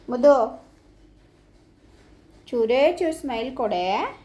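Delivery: usual speaking voice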